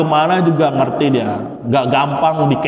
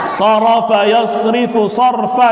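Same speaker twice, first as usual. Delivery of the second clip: recitation drill; 6 semitones higher, slower than usual. Shown as a demonstration. Teacher reciting the masdar gallery aloud, chanting. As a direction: recite each pattern clearly for the class.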